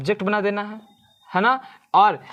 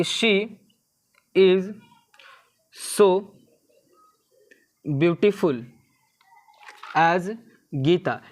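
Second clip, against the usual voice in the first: word-by-word dictation; much slower than usual. Shown as a demonstration. Teacher dictating example sentence word-by-word.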